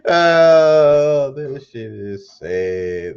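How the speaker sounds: loud mock wail then slow lament; hammed-up sob over the news; mock crying.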